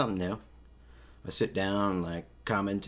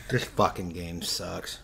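ordinary talk, nothing marked

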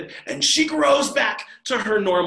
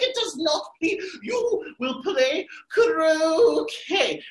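Queen of Hearts; 12 semitones higher, much slower than usual. Acted. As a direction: rage like a mad queen over her roses. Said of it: "she's like".

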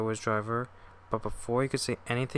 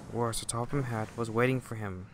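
ordinary delivery, nothing marked

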